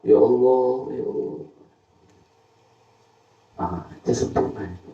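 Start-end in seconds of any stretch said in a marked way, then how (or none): 0.1-1.4 praying aloud. Retold or imitated.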